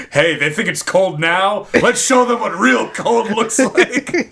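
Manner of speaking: high, hammed-up broadcaster; slow down into a gleeful mock announcement; imagined broadcasters. Picturing broadcasters reacting to cold, hammed-up announcer. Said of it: "I can just picture the broadcasters".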